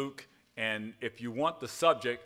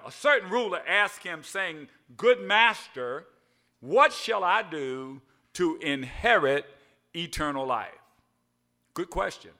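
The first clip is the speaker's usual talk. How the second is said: scripture reading; 4 semitones higher, 7 dB louder than usual. Acted. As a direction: read the verse out to the church. Reads scripture aloud.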